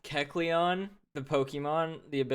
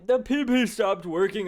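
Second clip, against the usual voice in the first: hammed-up alarmed cry, higher and louder; mock wail; 6 semitones higher, 4 dB louder than usual. Still mocking the joke with a higher, louder fake wail.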